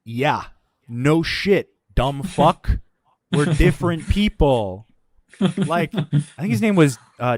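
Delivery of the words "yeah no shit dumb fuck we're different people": loud, spaced-out mock comeback; scornful retort. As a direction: punch it out as if to an idiot. Acted comeback to the insulting supervisor.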